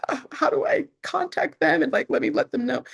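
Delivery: high, frantic retold panic; frantic worry. Telling listeners how she panicked over a wrong price, frantic and high. Panicked about overpaid customer.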